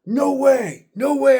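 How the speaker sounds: high, loud "no way" in disbelief; stunned excitement. Retold or imitated. Gamer beating the last boss, loud excited disbelief. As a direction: shout it like you can't believe the boss just fell. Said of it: "I was like".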